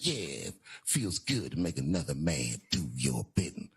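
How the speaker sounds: Puff. Acted. Caught man answering the intruder, deep self-satisfied voice. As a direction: drop deep and savour it. Acts out Puff's smug answer.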